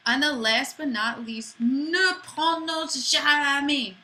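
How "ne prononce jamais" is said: ne prononce jamais slow and higher in French; French pronunciation. Shown as a demonstration. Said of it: "Don't pronounce".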